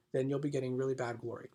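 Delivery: normal talking voice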